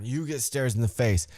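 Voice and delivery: ordinary delivery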